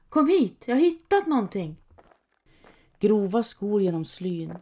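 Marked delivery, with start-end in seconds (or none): 0.1-1.8 calling out. A narrator's reading. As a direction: shout it across the woods. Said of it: "Han ropar efter det andra".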